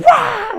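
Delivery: high, loud "waah" scare shout; sudden mock ghost roar; imitated ambush yell. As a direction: burst out to startle someone. Acts out jumping out at trainee.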